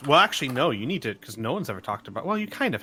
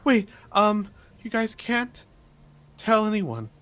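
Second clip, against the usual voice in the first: put-on higher-pitched, halting young-hero plea; earnest, secretive Tally; 5 semitones higher than usual. Tally begs friends to tell no one, in an earnest higher voice.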